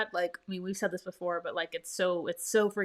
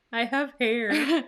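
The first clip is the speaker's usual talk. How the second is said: slow, drawn-out echo, louder; playful mimicry; 4 semitones higher, 5 dB louder, much slower than usual. Echoing co-host's silly fun fact, playful mimicry. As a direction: stretch it out as a teasing imitation.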